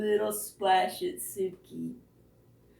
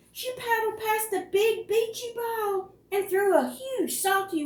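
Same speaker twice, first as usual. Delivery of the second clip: much higher, eager story voice; pitched-up narration of a brave rescue; excited high storytelling; 12 semitones higher than usual. A narrator's reading. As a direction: tell the rescue up high and thrilled. Narration jumps far higher.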